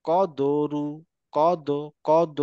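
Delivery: "কদরু" with দ bouncing as do; qalqalah echo. Shown as a demonstration. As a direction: pop the দ in "কদ" and "কদরু". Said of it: "দ, এরকম".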